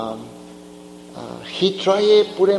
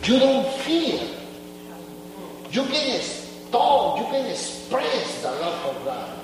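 ordinary delivery

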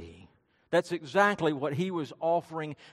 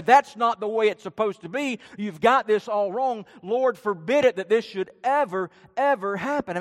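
louder, higher retold scolding; indignant rebuke; 3 semitones higher, 6 dB louder than usual. Peter points his finger at Jesus, indignant rebuke. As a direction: voice Peter's emphatic protest with raised force. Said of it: "point his finger at Jesus and say".

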